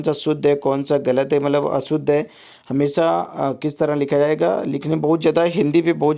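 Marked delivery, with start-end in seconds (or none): none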